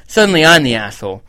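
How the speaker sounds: usual speaking voice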